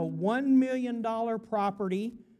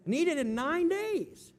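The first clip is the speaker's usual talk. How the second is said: ordinary talk, nothing marked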